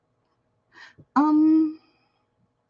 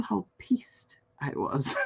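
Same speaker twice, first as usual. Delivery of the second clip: confiding whisper; 11 dB quieter, slower than usual. Real, not acted. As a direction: lean in and whisper it.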